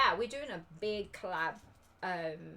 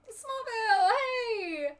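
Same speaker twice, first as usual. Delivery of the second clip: high, loud delighted hello; excited greeting; 12 semitones higher, 8 dB louder, much slower than usual. Real, not acted. Greeting another viewer.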